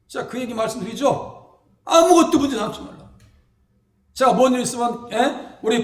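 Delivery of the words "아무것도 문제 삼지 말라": higher, louder proclamation; proclaiming motto. Real, not acted. Preacher to congregation, high loud proclamation. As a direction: declare it to the church.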